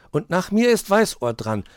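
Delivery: proposing the order; eager hare child. A narrator's reading. Hoppel proposes Weißohr goes next, high and eager. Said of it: "schlug Hoppel vor".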